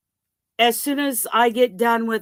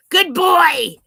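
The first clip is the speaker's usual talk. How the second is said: praising dog; 8 semitones higher, 8 dB louder than usual. Real, not acted.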